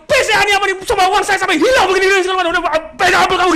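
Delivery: imitating a high, loud furious rant; angry customer. Customer raging at staff over his lost money, acted fury. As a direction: shout the complaint at full tilt. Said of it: "Marah begitu".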